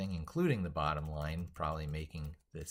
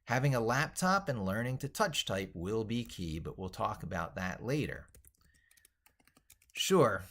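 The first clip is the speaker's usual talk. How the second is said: bright, emphatic book reading, higher and louder; animated reading aloud; 6 semitones higher, 5 dB louder than usual. Acted. Streamer reading his own book to viewers, lively read-aloud. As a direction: sell the book's pitch like an enthusiastic author.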